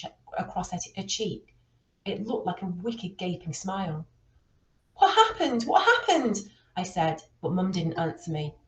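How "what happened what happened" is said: high, loud frightened cry; child horrified at mum's bruised face; panicked child. Acted. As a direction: blurt it out in shock and fear. Child sees mum's injured face.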